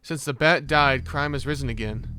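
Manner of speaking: normal talking voice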